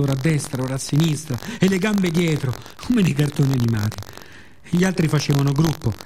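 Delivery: plain reading voice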